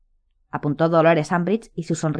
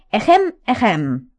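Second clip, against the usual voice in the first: slow prissy throat clearing; 3 semitones higher, much slower than usual. A narrator's reading. Umbridge's mannered cough.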